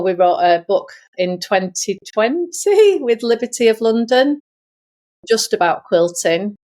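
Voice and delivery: normal talking voice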